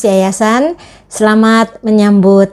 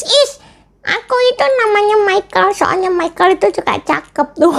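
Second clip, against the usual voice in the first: put-on much higher childlike puppet voice; puppet friend; 9 semitones higher than usual. Teacher voicing her puppet for the children, high puppet voice. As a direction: play the cheeky puppet sidekick, pitched way up.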